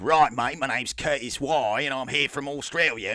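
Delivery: hammed-up bad Australian accent; parody Australian accent. Musician introducing himself as from Australia, parody Australian accent. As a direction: imitate an Australian with deliberately overdone vowels. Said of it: "more of a parody of an Australian accent".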